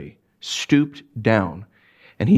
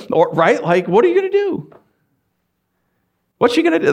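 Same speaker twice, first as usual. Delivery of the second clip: ordinary talk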